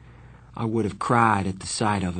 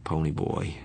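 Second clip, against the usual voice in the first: husky voice; 6 semitones lower, 6 dB quieter than usual. A narrator's reading. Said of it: "said in a husky voice".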